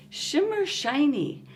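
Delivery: ordinary talk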